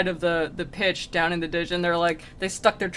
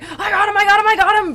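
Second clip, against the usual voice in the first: high, loud cartoon yelling; mock frantic eagerness; 12 semitones higher, 10 dB louder than usual. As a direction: blurt it like an overexcited chaser. Acted demon chasing in flight.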